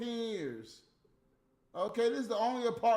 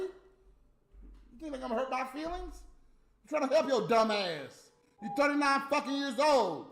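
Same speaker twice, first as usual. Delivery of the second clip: louder, higher exasperated scolding; fed-up lecture, voice raised; exasperated rant; 6 semitones higher, 4 dB louder than usual. Real, not acted. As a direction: scold her for her own good. Ranting at Natalie.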